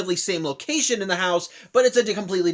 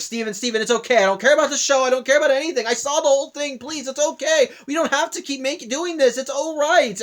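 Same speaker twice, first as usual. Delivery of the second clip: higher, louder imitation of panicked reassurance; panicking; 7 semitones higher, 3 dB louder than usual. Peridot frantically reassuring Steven after his breakdown, imitated panic, higher and louder. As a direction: pitch the voice up and rush to calm him, a touch frantic. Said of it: "just panicking herself".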